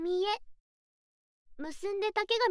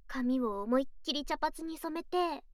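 ordinary delivery

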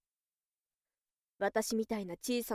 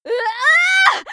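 resolute war cry; 14 dB louder, much faster than usual. Acted. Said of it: "覚悟を込めた声を響かせる".

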